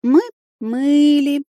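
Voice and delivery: slow, whiny, plaintive drawl. Acted. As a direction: stretch it out pitifully. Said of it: "жалобно протянула девушка".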